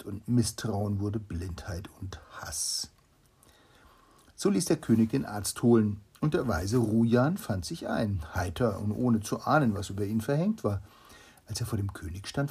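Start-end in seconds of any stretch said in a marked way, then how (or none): none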